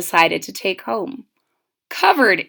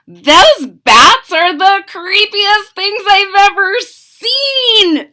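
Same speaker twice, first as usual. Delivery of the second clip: put-on much higher, louder girl voice; little girl, thrilled; 9 semitones higher, 6 dB louder, slower than usual. Mitzi sees the room of bats: high, thrilled girl voice. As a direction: gasp with delight at the bats. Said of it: "said Mitzi".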